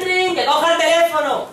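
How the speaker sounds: high, loud ringtone turning into a shout; yelled ring and order. Acted. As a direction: sing the ring, then bellow. Ringing phone turns into yelled order.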